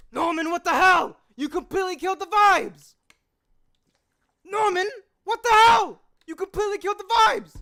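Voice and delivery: high, loud exasperated shouting; outraged yell. Acted. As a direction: yell at a friend who ruined everything. Outraged character in voice acting takes.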